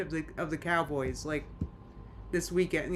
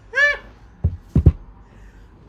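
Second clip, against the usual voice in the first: high, loud burst of a laugh; sudden high-pitched laughing outburst; 10 dB louder than usual. Real, not acted. Streamer denying that she is laughing, high loud laugh. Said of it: "you're laughing. I'm not laughing".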